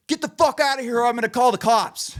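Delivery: high, loud, irate yell; hammed-up furious warning, high and loud; angry threat. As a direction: act out a worker chasing someone off. Acted worker threatening the caller.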